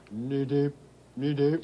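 lightly imitated frog call; frog croak. Woman copying the frogs' talk, light croak imitation. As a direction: try a gentle ribbit. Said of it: "That's the way they sometimes talk".

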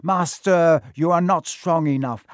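urgent protest. A narrator's reading. Quirrell objects to his hidden master, urgent protest. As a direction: protest forcefully to your master.

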